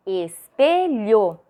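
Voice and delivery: "Espelho" slowly broken into es, pe, lho; "Espelho" drawn out, each syllable given its own beat. Shown as a demonstration. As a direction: sound out "Espelho" in separate syllables. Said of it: "Vamos separar em sílabas".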